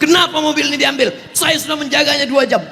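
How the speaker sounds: high, fast indignant protest; mock raging. Parking attendant whose guarded car is taken, mock raging. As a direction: act out a parking attendant throwing a fit. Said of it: "Ada nggak tukang parkir ngamuk-ngamuk".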